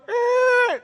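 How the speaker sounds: high-pitched drawn-out door creak. Retold or imitated. Preacher acting out prison doors opening, high creak. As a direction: imitate a squealing hinge with the voice.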